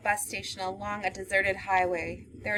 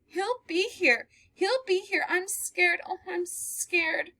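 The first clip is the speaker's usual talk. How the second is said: much higher, slow and trembling; panicked fear; 10 semitones higher, slower than usual. Acted. Girl telling the man she is scared he will come. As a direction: fear spilling over.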